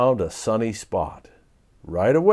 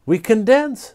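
bright, louder answer; happy; 10 semitones higher, 5 dB louder than usual. Acted. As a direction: cheerfully answer the teacher. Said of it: "said Phoebe happily".

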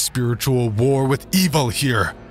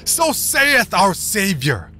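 screaming sermon; 9 semitones higher than usual. Acted. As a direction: scream it to whip up the crowd. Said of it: "screaming at the top of his lungs".

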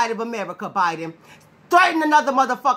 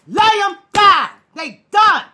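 triumphant taunt; 7 semitones higher, 5 dB louder, slower than usual. Real, not acted. Triumphant taunt after build-up.

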